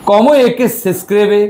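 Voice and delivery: "Responda las preguntas" drawn out syllable by syllable; deliberate, slow classroom reading. Shown as a demonstration. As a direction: read the instruction slowly for students. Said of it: "এইবার বলেন".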